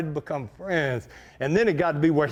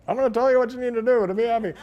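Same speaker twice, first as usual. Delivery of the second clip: higher, fast, hammed-up seminar pitch; mock guru; 7 semitones higher, faster than usual. Mocking motivational speakers at the Holiday Inn, hammed-up and fast. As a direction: send up a cheesy self-help speaker.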